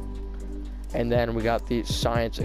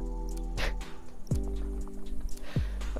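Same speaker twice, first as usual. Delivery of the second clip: quiet breathy laugh; 7 dB quieter than usual. Real, not acted. Laughing at invisible sculpture story.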